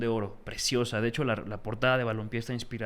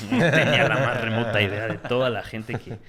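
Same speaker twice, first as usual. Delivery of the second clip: laughing through the words, louder; 6 dB louder than usual. Real, not acted. Contando a los conductores, entre risas. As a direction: laugh it out in disbelief. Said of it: "laughs while saying it".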